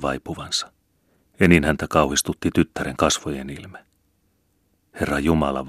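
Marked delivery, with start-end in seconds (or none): none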